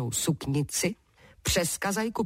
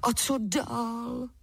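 higher, drawn-out giant's promise; eager giant, slow and raised; promising giant; 6 semitones higher, much slower than usual. A narrator's reading. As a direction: promise readily, stretching the last word. Giant eager for next condition.

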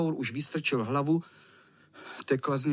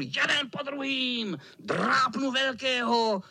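very high, piping cat threat; high villain squeal; squealing tomcat; 8 semitones higher than usual. Acted. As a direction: squeal it, stretching the end. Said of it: "pištěl kocour".

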